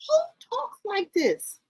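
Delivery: high, appalled question; real high-pitched incredulity; incredulous. Real, not acted. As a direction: squeal it in disbelief. Appalled reaction to the clip's talk.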